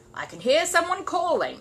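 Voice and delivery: listen and report; gruff neighbour. Acted. Mr Curry at the box.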